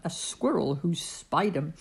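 plain reading voice

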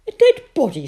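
high, loud puzzled echo; surprised question. Acted. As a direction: toss the words back, puzzled. Mother repeats Sophie's alarm.

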